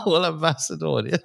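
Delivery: laughing through the words. Real, not acted. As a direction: giggle it out, high and amused. Laughing at his own joke.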